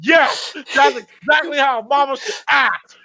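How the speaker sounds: high excited praise; excited approval. Retold or imitated. Viewer cheering the mama, high and excited. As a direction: cheer her on. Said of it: "I'm like".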